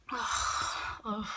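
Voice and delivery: breathy exasperated ugh; frustrated groan. Real, not acted.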